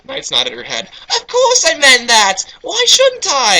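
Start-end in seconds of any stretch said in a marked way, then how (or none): 1.1-3.6 put-on slow, very high falsetto girl. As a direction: insist sweetly in a squeaky girl voice. Knights voiced, slow falsetto.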